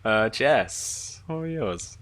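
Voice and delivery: normal talking voice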